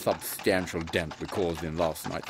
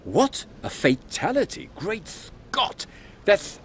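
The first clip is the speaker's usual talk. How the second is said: high, startled exclamations; stunned gasp of disbelief, much higher; shocked; 12 semitones higher, slower than usual. Acted. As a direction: jump up in pitch with genuine shock. Shocked by news of a death.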